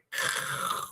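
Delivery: long voiceless rush of noise; imitated attack noise. Host miming what an angry Leo would do, voiceless noise. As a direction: make a soft hissing noise for the violence.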